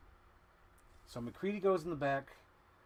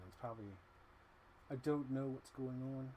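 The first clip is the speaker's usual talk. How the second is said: ordinary talk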